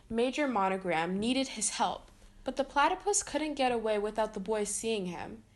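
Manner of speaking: ordinary delivery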